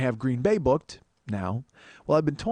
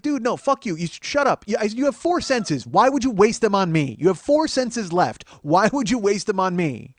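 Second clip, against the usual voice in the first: higher, louder, slow and deliberate scolding; heated put-down; 6 semitones higher, 5 dB louder, slower than usual. Retold or imitated. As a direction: lecture the heckler, punching each word. Berating heckler from stage.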